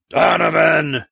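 loud roar of a wounded giant. A narrator's reading. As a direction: roar the name as you throw. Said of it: "roared Wocha".

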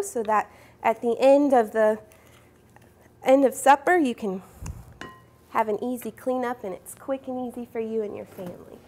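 ordinary talk, nothing marked

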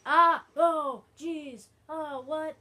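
string of very loud, high bursts; shocked yelling. Real, not acted. Player hit right near the prize, shocked yelling. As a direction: yelp each word separately, ending in disbelief. Repeated shock during gameplay.

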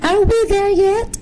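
put-on very high-pitched child voice; high, teasing little-girl question; giggly little girl. A narrator's reading. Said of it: "Jenny giggled in a soft voice".

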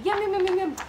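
high, rapid-fire yums; squeaky nibbling chatter; munching mouse. Acted. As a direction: gobble like a tiny mouse.

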